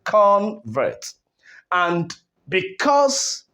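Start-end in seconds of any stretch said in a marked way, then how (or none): 0.0-1.1 "convert" with a strong, long CON; CON-vert, two parts, force on the first; stress on first syllable. Shown as a demonstration. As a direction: punch the CON in "convert", let "vert" drop. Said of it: "syllable where we have the stress".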